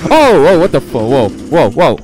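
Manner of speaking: loud, high alarm; startled. Real, not acted. Streamer startled by the game, loud alarm. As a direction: burst out in sudden alarm.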